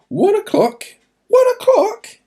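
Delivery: high, loud, rising astonishment; amazed realisation. Acted. Realising the miracle's timing.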